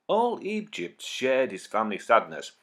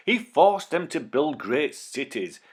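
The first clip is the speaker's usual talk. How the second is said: plain reading voice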